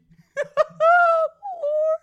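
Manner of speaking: high, loud delighted cackle; laughing at the fanfic; shrieking laugh. Real, not acted. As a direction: burst into squealing laughter. Reacting to boys.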